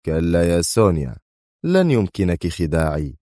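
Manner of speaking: usual speaking voice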